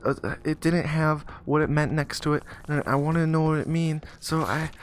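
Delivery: put-on high, stammering kid voice; breathless mock little-boy explanation; flustered boy. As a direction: babble like a panicking child. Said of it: "So the boy catches his breath".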